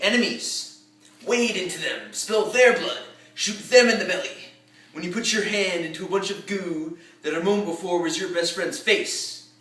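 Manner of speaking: rousing war-movie commander's speech, pausing between phrases; rally the troops, building to loud, punched battle orders; commanding pre-battle address that swells, then drops off; General George C. Patton. Acted. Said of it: "the opening monologue for the war movie Patton, as General George C. Patton addresses".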